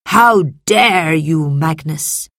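slow, loud, indignant senator; angry shout. A narrator's reading. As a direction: draw out the outrage as you challenge him. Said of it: "he shouted at Marcus's father".

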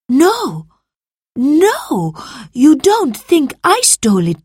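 high, loud, distressed protest; upset denial. A narrator's reading. Salvius to accusing master, very upset. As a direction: deny the theft in dismay. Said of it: "He looked very upset".

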